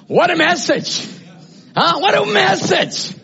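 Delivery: high, loud shouts of triumph; exultant preaching. Real, not acted. Preacher exulting that they will get out of here, shouted preaching. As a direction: cry it out to the whole church.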